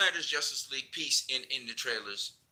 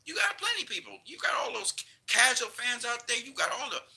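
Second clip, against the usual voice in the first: much higher, mocking lilt; mock them with a high voice; scornful sing-song; sarcastic; 9 semitones higher than usual. Real, not acted. Mocking studio's casual fans.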